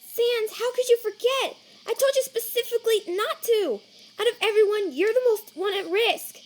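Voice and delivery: high, loud, panicked telling-off; frantic scolding. Acted. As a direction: scold them at a high pitch out of sheer worry. Alarmed scolding of Sans.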